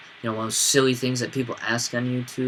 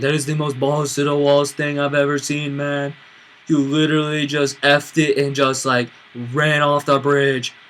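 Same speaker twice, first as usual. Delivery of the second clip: excited; 3 semitones higher, 4 dB louder than usual. Retold or imitated. Roommate praising wildly.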